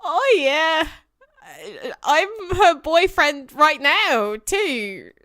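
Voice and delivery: hammy Australian drawl; mock Aussie, slow and loud; bad Australian accent. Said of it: "really bad Australian accent".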